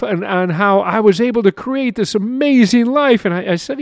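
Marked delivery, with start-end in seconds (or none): none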